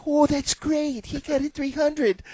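very high, slow, sweet mock praise; polite mom. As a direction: humor him gently.

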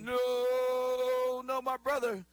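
long, high, loud drawn-out no; high-pitched, joking denial; playful protest. Real, not acted. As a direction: protest loudly at the teasing, stretching it out. Playful protest at co-host's teasing jab.

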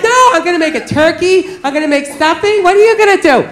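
imitating an eager girlfriend listing plans, rising high; mock holiday enthusiasm; excited girlfriend. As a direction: bubble over, then pitch the question up. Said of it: "so she's all excited".

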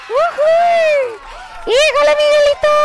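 high put-on character voice; character shout. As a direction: yelp it like a cartoon sidekick. Mimicking a character's exclamation.

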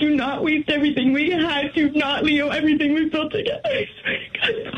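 tearful, breaking plea; begging through real tears; crying plea. Real, not acted. Wife begging husband on call.

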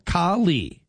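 Kali drawn out, both syllables evenly stressed; equal syllable stress. Shown as a demonstration. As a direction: say "Kali" slowly, weighting each syllable alike. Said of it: "equal emphasis on both syllables".

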